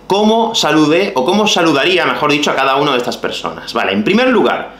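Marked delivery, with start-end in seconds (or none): none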